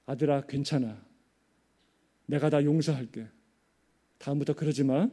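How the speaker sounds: low, soft, reassuring voice; tender fatherly hush; forgiving father. Acted. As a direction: gently let the child off, low and quiet.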